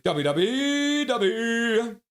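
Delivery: high, slow mock voice calling Dobby; hammed-up impression of a friend; imitating Even. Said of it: "And then Even's like".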